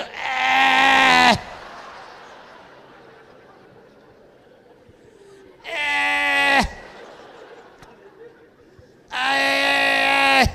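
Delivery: high, loud shouts of pain; staked vampire crying out loud and high; loud pained cries. Acted. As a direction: yelp loudly, high-pitched.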